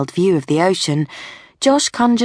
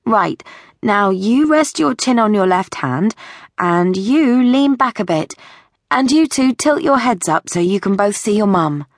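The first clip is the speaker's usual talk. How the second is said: put-on higher-pitched, brisk directing voice for posing children; cheerful photographer giving instructions; Sophie the upbeat photographer; 4 semitones higher than usual. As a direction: coax the children into their poses, pitched up.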